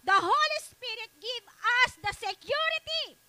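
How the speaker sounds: high, loud preacher's call to the congregation; fervent preaching. Acted. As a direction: preach it at full force, rising. Preaching at full force. (masigasig na pangangaral nang malakas)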